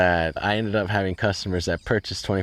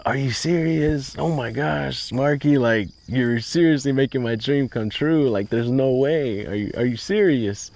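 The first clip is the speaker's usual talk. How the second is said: overjoyed disbelief; 6 semitones higher than usual. Retold or imitated. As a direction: gasp it out, dream coming true. Hears the loan is approved.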